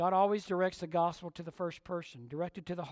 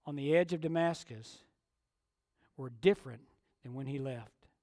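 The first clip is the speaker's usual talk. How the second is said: ordinary delivery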